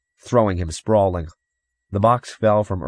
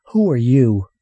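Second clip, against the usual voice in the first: put-on raised, loud boy's challenge; demanding; 5 semitones higher, 7 dB louder than usual. A narrator's reading. Boy confronting a shadowy stranger, demanding. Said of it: "Earl demanded, his voice low".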